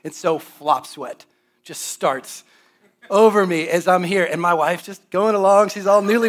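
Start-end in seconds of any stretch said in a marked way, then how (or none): none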